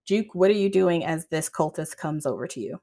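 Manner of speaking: ordinary talk, nothing marked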